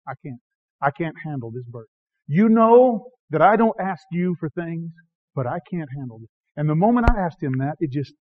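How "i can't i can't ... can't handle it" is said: broken prayer. Retold or imitated. Preacher retelling prayer to God, soft broken plea. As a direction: plead quietly, spent and broken. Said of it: "I looked up to God and I said".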